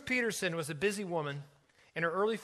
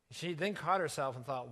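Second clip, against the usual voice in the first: plain reading voice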